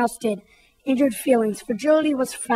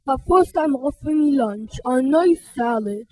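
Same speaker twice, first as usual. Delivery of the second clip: calmed bully. Acted. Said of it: "He said".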